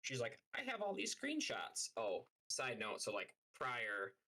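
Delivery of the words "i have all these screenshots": squeaky high mocking whine of her complaints; mock her rant in a silly high squeak. Mocking friend showing screenshots.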